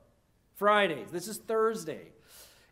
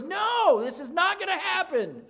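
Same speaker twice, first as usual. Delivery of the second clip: protesting Peter; 10 semitones higher, 6 dB louder than usual. Acted. Peter protests in retold scene.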